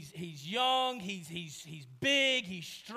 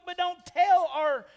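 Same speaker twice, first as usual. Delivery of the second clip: ordinary talk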